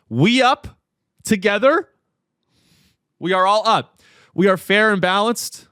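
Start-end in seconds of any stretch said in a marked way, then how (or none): 0.1-1.9 Austin Texas slang. Retold or imitated. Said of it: "as they say in Austin, Texas".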